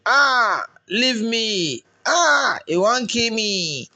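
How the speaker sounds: high anguished wails building up; distant crying. A narrator's reading. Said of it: "crying from wherever she was".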